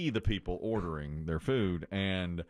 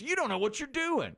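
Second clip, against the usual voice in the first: mock scolding. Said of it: "She goes".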